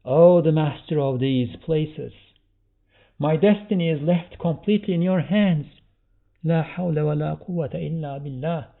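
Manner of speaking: loud, high devotional cry; exclaiming. Acted. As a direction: proclaim it as a fervent invocation. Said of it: "we should all exclaim".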